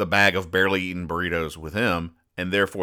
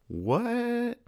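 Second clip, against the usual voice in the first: much higher, pretend-baffled What; mock surprise; 7 dB quieter than usual. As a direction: play up the confusion, pitched way up. Mock puzzlement at question mark.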